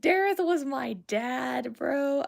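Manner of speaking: slow, drawn-out insistence; insistent restatement. Real, not acted. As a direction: stretch it out without shouting. Repeats the exclamation more calmly.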